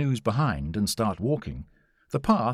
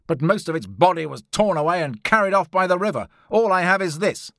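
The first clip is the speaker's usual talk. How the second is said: louder, higher indignant explorer; Challenger's voice; 7 semitones higher, 6 dB louder than usual. A narrator's reading. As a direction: push the tale out forcefully, pitched up. Narrator voices Challenger's account.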